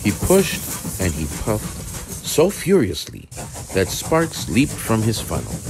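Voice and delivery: ordinary delivery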